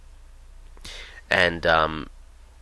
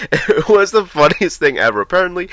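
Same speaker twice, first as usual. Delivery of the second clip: laughing; 12 semitones higher, 8 dB louder than usual. Real, not acted. Laughing recalling the bowing.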